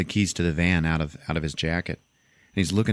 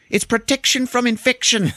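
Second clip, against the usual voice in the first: high, louder, proudly earnest; squeaky earnest answer; 5 dB louder than usual. Retold or imitated. Charlto explains his cardboard armor to the driver, high and proud. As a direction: chirp it as if it's obvious. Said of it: "Charlto just goes".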